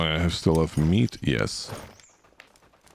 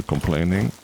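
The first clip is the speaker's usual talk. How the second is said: ordinary talk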